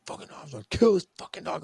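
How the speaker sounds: high, fast, strained mock yell; retold yelling. Dad going nuts at the dog, retold furious yell. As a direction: rant furiously with the volume held back. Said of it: "I was yelling at the dog".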